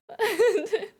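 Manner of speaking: laughs out loud, high and bursting; can't hold back a laugh; bright laugh. Real, not acted. Laughing at her friend's donkey mix-up, a high burst.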